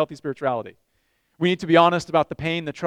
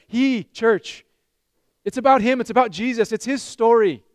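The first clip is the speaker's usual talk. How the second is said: louder, higher, building up; fervent preaching; 4 semitones higher, 5 dB louder than usual. Real, not acted. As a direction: drive it home to the church. Preacher rising to emphasis.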